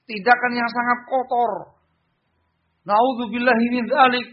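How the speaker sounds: high-pitched indignant condemnation; demonstrated angry sermon voice; angry denunciation. Shown as a demonstration. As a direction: denounce it as an angry preacher. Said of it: "marah dia".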